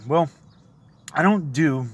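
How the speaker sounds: usual speaking voice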